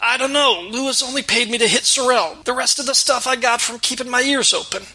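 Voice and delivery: rough thug snapping back; demanding waiter. Acted.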